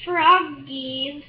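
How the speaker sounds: usual speaking voice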